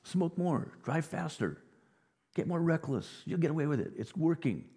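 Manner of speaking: enemy cheering on a reckless life, mock sweet; flattering enemy. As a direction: egg them on like a fake friend. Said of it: "Your enemies will blow smoke".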